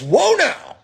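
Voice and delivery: high-pitched mock "whoa" yell; imitated protest yell. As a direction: imitate an alarmed man calling out. Imitates dog owner's protest.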